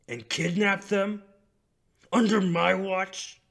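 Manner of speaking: slow seething build to a high, furious roar; angrier and angrier. Acted. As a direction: start forceful and slow, escalate into full-voiced outrage. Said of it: "getting angrier and angrier".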